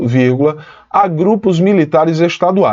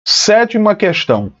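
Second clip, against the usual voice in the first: ordinary delivery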